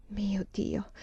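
very soft, whispered invocation; lamento sommesso. A narrator's reading. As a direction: breathe it out in hushed regret. Lamento di rimorso. (invocazione sussurrata, di rimorso)

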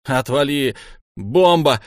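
loud, high angry warning; sharp, loud outburst; hollering. A narrator's reading. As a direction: yell to drive him off. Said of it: "орёт он".

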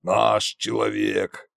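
put-on slow, low smirking old tsar; lightly menacing smirking Koschei. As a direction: drawl it with a satisfied smirk. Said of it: "ухмыльнулся Кощей".

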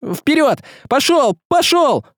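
high, urgent driving cries; shouted command. A narrator's reading. Василий spurs Гришка across the field, shouted commands. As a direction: urge a mount into a gallop. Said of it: "и скомандовал".

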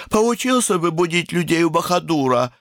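высокий капризный девичий голос; недовольно, по-детски, высоко; капризная девочка. A narrator's reading. Сонная капризная сестра. (капризный высокий голосок)

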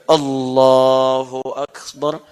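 slow, drawn-out Allahu Akbar; trembling takbir. Retold or imitated. Preacher voicing Abu Bakar's slow, awed takbir. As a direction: stretch the takbir slowly, in awe.

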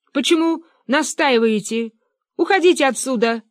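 high, indignant squeaking. A narrator's reading. Fyodor Kuzmich shoos the hunters from hiding, indignant squeak. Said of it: "пискнул Фёдор Кузьмич".

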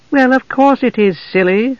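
wife teasing her husband with amusement; amused. A narrator's reading. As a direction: tease him fondly. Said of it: "amusement glinting her eyes".

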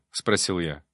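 plain reading voice

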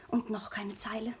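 breathless whisper of disappointment; whispered despair. Acted. Sabine whispering alone, no letter came, hushed. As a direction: whisper it to yourself, crushed. Lovesick girl awaiting a letter.

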